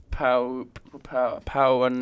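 halting, slow tries at "Pauanui"; sounding it out. Real, not acted. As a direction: stumble through "Pauanui" piece by piece. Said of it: "Sound it out".

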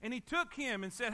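normal talking voice

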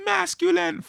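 masculine said in a high squeak. Retold or imitated. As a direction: jump way up for masculine. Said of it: "that's her, like, voice too".

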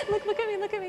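high, pleading little sing-out; coaxing a child. Retold or imitated. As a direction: coax a sobbing child to focus. Teacher calming a sobbing boy.

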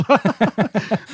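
short high burst of real laughter; nervous laugh at the spooky memory. Real, not acted. Spooked, laughing at own scream.

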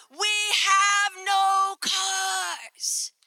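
very slow, drawn-out New York shout; New York clerk refusing, drawn out; New York accent. Retold or imitated. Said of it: "in their New York accent".

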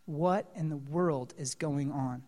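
low, soft amazement; awed hushed question; hushed wonder. Real, not acted. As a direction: murmur the question in awe. Preacher's hushed rhetorical wonder.

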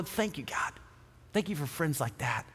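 soft private thank-you to God; quiet prayer. Retold or imitated. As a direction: pray it under your breath. Silent thanks for a friend.

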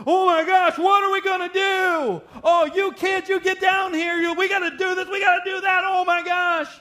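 panicked high-pitched yelling; freaking out. Acted. Dad acted out losing it at his kids after storm damage, frantic shouting. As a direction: shout orders frantically, voice pushed way up and loud. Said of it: "He didn't go in there freaking out".

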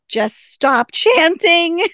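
high, loud, drawn-out exasperated plea; really upset. Retold or imitated. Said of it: "Doc is getting really upset".

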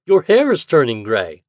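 put-on boyish cry. A narrator's reading. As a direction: cry it out to a pal. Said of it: "cried Toad".

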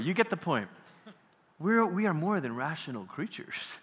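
ordinary delivery

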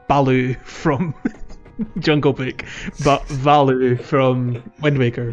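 usual speaking voice

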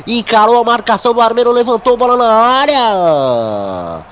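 rising with the attack; excited play-by-play. Acted. (narração empolgada do lance)

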